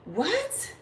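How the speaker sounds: high, breathy-free shocked what that fades out; a short high stunned "What?"; mock shocked reaction. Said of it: "and I'm like".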